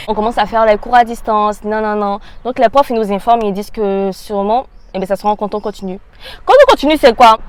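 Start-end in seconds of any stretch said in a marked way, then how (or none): 6.4-7.4 high, loud, baffled outburst; exasperated question. Retold or imitated. Student challenging teachers about contrôle continu, exasperated. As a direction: demand an explanation, voice shooting up.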